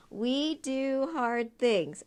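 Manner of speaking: each word spaced out slowly; slow and deliberate. Shown as a demonstration. Reading the T-shirt slogan to the guest, slow and deliberate. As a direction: read it like a motto, one word at a time. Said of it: "It says".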